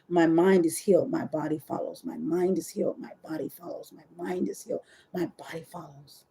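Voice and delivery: mantra fading to a whisper; quiet chant dropping into a near-whisper; murmured mantra. Shown as a demonstration. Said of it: "literally what I say".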